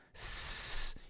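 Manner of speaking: "S" as a long, soft hiss; isolated S sound. Shown as a demonstration. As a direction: hold the hiss of "S" without any vowel. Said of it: "Tenta fazer". ("S" prolongado, só o chiado)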